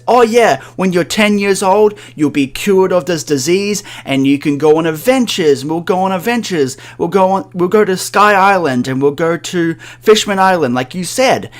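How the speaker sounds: father faking excitement to comfort his dying daughter; forced cheer. Retold or imitated. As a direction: sell the lie with big cheerful energy. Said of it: "so Kuma says".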